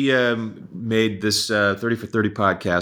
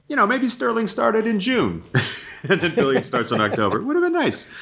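wistful high joke; 11 semitones higher than usual. Real, not acted. Wishful joking about schedule.

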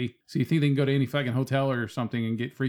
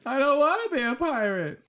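high whiny voice; 13 semitones higher than usual. Retold or imitated. Quoted clip line.

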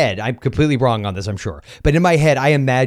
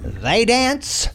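ringmaster; 7 semitones higher, slower than usual. Acted. Barker intro of co-host.

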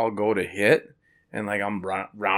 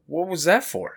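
higher, a little louder surprised question; startled protest; 9 semitones higher, 4 dB louder than usual. Retold or imitated. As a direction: caught off guard by a smack. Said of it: "I go".

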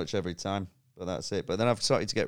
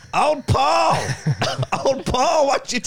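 high, loud mimicked kids' yell; shouting kids; 6 dB louder, slower than usual. Kids shouting at an older player over the headset, mock yell. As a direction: yell it out like a pestering kid. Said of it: "loads of kids shouting at you".

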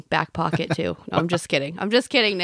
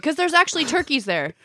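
ordinary delivery

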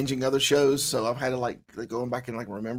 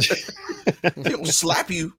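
laughing; 3 semitones higher, much slower than usual. Real, not acted. Laughing joke about Southern mama.

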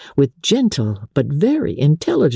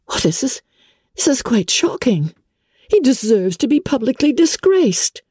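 put-on higher young woman's voice, shocked and indignant; Elizabeth, indignant; 11 semitones higher than usual. A narrator's reading. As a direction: rise up in disbelief at the injustice.

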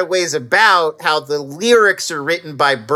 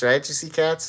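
normal talking voice

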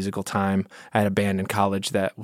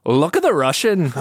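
higher, sing-song fake Irish; stepdad's same Irish accent; 5 semitones higher than usual. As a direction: do the mock Irish lilt for Russian. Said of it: "he would do the same accent".

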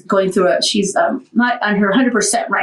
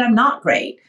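ordinary delivery

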